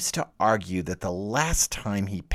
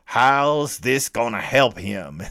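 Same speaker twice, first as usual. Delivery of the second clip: drawled Southern gas-station attendant; a small-town Southern mechanic, slow and lazy; southern filling station accent; 3 semitones higher, 5 dB louder, slower than usual. Acted. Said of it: "I gave that demon a southern filling station accent".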